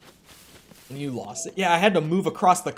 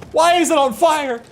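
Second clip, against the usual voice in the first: loud, high sudden alarm; startled outburst; 13 semitones higher, 10 dB louder than usual. Real, not acted. Streamer spotting something burning mid-story, loud and startled. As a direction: jolt up at the sight.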